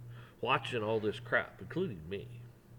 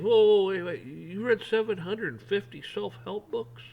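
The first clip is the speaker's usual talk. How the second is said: loud high whoa, then slowed incredulous question; stunned disbelief; 8 semitones higher, 8 dB louder, slower than usual. Retold or imitated. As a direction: stop him cold in disbelief. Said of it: "the guy that was speaking goes".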